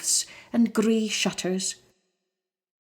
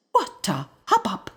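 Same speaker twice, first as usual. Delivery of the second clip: usual speaking voice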